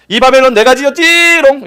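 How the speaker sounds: high, loud taunting brag; boastful gloat. Acted. Nebuchadnezzar boasting from the palace roof, high and gloating. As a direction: crow over it like a smug child. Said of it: "뭐라고 외친지 아세요?".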